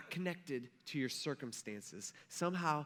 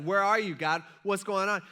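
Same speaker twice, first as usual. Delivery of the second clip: loud, higher-pitched demanding questions; acted abandoned cry; 5 semitones higher, 9 dB louder than usual. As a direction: call out loudly for an absent God. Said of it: "you ever feel abandoned?".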